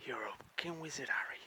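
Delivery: fast hushed whisper of a film line; whispered movie line; whispered quote. Acted. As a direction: breathe it like a secret. Harry Potter quote at film site.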